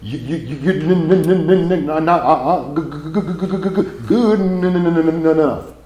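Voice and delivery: loud, high, heavily stuttered; mock-stuttering inner critic; stutter filter. Shown as a demonstration. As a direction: stammer through every word. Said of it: "I call it the stutter one".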